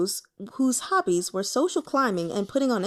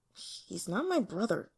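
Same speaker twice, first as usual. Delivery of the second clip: softer, sulky under the breath; mumbled; 6 dB quieter than usual. Acted. Youth mumbling a denial about his brother. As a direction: mumble it to the floor. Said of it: "then mumbled".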